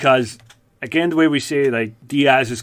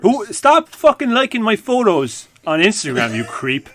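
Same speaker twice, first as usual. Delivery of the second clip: louder, higher, hammed-up annoyance; mock angry outburst; 8 semitones higher, 4 dB louder than usual. Host vents at Brendan Schaub, hammed-up angry outburst. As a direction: snap it like a fed-up rant.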